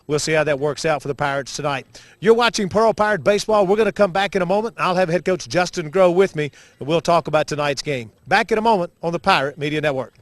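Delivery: upbeat play-by-play announcer read; sports broadcast intro. Acted. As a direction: open the broadcast like a radio host. Broadcaster opens the game show.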